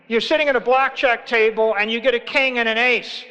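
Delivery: ordinary talk, nothing marked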